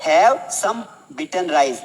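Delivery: very slow, every word separated; slow model phrase. Shown as a demonstration. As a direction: model it slowly for learners to repeat. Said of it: "poha लीजिए तो क्या बोलोगे?".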